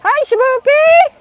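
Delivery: high, loud, stretched-out call to her husband. Real, not acted. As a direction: sing out a slow, delighted hello across the house. Greeting husband coming home.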